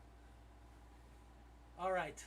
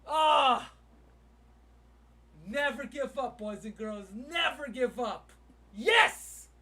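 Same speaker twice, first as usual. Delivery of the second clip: loud, high victory cry; real exultant shouting; triumphant yelling; 4 semitones higher, 13 dB louder, slower than usual. Real, not acted. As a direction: holler it like a rallying cry. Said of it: "Sorry for yelling".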